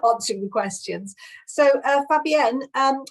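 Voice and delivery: ordinary talk, nothing marked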